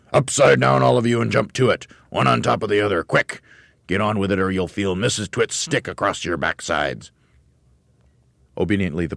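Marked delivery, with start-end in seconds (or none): 0.1-7.1 higher, louder drill bark; barked command. Acted. Mister Twit barking orders at the monkeys. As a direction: bark like a fearsome trainer. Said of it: "fearsome monkey trainer's voice".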